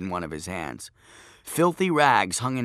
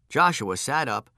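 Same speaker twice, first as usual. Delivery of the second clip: ordinary delivery, nothing marked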